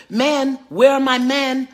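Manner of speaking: high, loud call to the crowd. Real, not acted. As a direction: summon the men in the audience. Calling out to the men.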